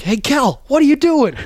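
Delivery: high, loud needling call to his brother; mocking brother. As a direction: bicker at your brother on the bench. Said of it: "Connor will be like".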